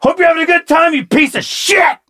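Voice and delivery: cuss out an absent husband in mock fury; mock-enraged rant, stretched at the end; imitated cussing out. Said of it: "she's cussing him out".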